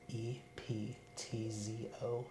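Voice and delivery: ordinary talk, nothing marked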